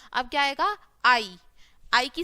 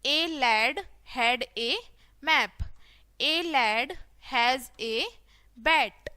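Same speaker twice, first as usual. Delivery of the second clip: very slow, separated words; word-by-word reading; much slower than usual. Shown as a demonstration. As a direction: read it out slowly for beginners. Teacher models reading word by word. (धीरे-धीरे, एक-एक शब्द पढ़ना)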